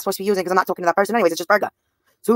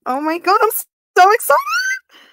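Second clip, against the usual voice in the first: very high, slow, stretched-out squeal; squealed excitement; 13 semitones higher, 3 dB louder, much slower than usual. Acted. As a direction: squeal it out like a giddy fangirl. Squealing over romance.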